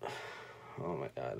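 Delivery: low, slow, sighing; fighting to stay composed; choked-up sigh before speaking; holding back tears. Real, not acted. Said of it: "I'm not gonna get emotional".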